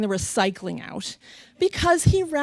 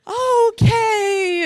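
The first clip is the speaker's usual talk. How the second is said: high, stretched-out fake keenness; forced enthusiasm; 11 semitones higher, 4 dB louder than usual. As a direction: try to match someone's excitement. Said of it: "trying to match his enthusiasm".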